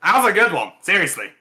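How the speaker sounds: mean it as a big-hearted winner; champ's hearty compliment; the champ, sincere. Acted.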